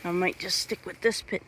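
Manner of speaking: ordinary delivery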